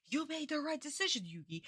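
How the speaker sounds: mocking dub quote. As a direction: sneer the cheesy line back at the show. Mocks the same dub line again.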